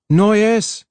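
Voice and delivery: high, urgent call. A narrator's reading. Harlan searching the corridors for Noyes, calling out. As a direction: call out a name while searching.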